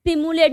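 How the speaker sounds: सिमुलेट said with plain s, no t, sim-yu-late; correct pronunciation. Shown as a demonstration. Teacher correcting the pronunciation of सिमुलेट for students. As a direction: say सिमुलेट starting si, not sti. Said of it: "not stimulate".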